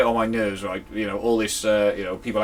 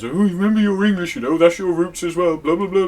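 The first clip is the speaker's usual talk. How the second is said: mock-stern English father, higher and louder; a dad reminding his kid of English roots; 7 semitones higher, 6 dB louder than usual. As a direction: lecture like a nagging English dad. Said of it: "an English father who always says".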